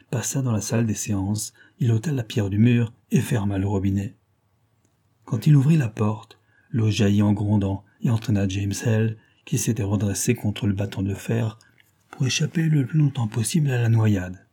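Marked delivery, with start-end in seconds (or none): none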